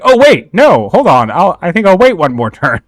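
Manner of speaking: high, loud "hold on" backpedal; mock alarm, loud and swooping high; sudden second thoughts. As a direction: act out a panicked change of mind, voice leaping up. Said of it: "and was like".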